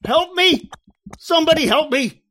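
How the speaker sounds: high, loud desperate screams; sinking in terror; screaming for help. Acted. John sinking in the tar pits, loud panicked screams.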